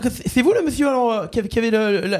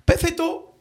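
"Perfetto" raised, hammed-up Italian; mock Italian accent; 6 semitones higher, slower than usual. Prank caller shows off the fake accent, mock Italian. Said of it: "avec l'accent".

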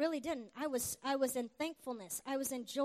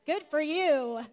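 drawn-out, louder, dismissive good for you; deadpan brush-off of a friend's bragging, played for laughs; mock sarcasm; 2 semitones lower, 6 dB louder, slower than usual. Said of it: "I'm like".

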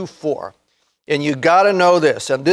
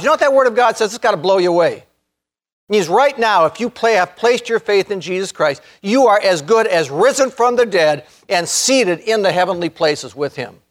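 normal talking voice

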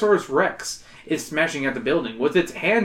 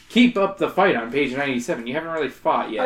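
normal talking voice

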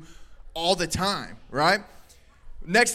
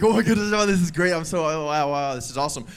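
hurried excited gushing, tailing off lower; play-acted giddy rush, sinking in pitch; mock excitement; 5 semitones lower than usual. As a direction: imitate someone gushing with excitement. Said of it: "some of us get really excited".

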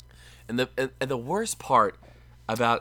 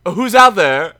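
hammed-up, higher, much louder call into the dark; mock scared yell; 6 semitones higher, 15 dB louder than usual. Mocking a movie character who shot at nothing, hammed-up yell. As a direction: ham up a jumpy gunman's cry. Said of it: "and then goes".

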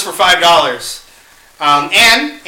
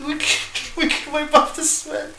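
laughing; 9 semitones higher, 7 dB quieter than usual. Real, not acted. Laughing while explaining sweat rag.

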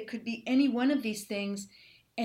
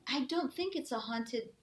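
usual speaking voice

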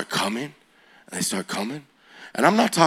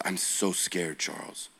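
frightened whisper; 4 semitones lower, 11 dB quieter, slower than usual. Retold or imitated. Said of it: "She goes".

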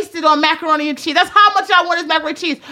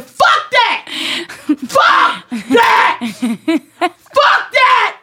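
screaming in fury, much higher and louder; 8 semitones higher than usual. Retold or imitated. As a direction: build into a full furious yell. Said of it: "I was screaming so loud".

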